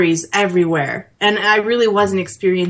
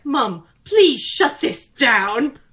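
pleading robot butler; 4 semitones higher, much slower than usual. Retold or imitated. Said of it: "he's like".

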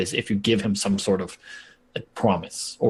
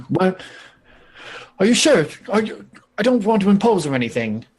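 hesitant disbelief; 7 semitones higher than usual. Acted. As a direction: politely check the offer is real, voice lifted. Displaced NPC offered work, hesitant.

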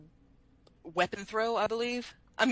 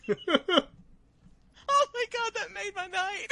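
high, loud helpless laughter; laughing hard; 14 semitones higher, 5 dB louder, much slower than usual. Real, not acted. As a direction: crack up completely. Bursting out laughing.

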